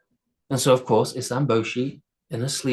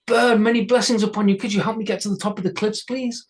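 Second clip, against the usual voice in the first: higher, quick polite asking; tiny Isamboshi; 8 semitones higher, faster than usual. A narrator's reading. Isamboshi asking the bird for a ride, high and polite. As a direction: plead with the bird nicely. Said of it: "called down a bird".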